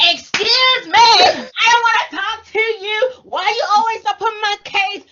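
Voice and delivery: high, loud mock whine; hammed-up whining; mock whiny complaint. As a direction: overdo the aggrieved complainer. Imitating someone's overdone complaint.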